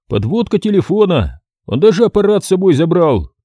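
возбуждённый хозяин. Acted. Хозяин отвечает, голос выше.

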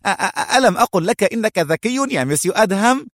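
high-pitched stammering eagerness; eager. A narrator's reading. Eliezer jumps at Adham's apparent agreement, eager and high. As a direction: agree too eagerly, voice shooting up. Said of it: "صاح إليعازر بلهفة".

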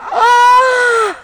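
long high loud scream; terrified scream. Acted. Woman in labour screams, long high terrified scream. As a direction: scream in terror. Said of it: "страшно закричала роженица".

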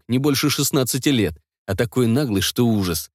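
ordinary delivery

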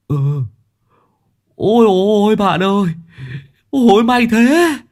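high, loud joyful cries; overjoyed relief. Acted. Long Tứ overjoyed to see his friend, high loud cries. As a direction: gasp with delight.

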